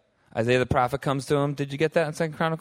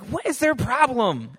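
exasperated; 10 semitones higher, slower than usual. Retold or imitated. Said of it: "were any of you like".

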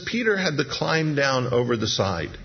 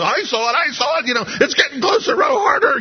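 much higher, rising to a frantic shout; panicked disciples; 13 semitones higher, 4 dB louder than usual. Retold or imitated. Disciples in the storm yelling to row harder, panicked. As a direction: cry out in growing panic to row.

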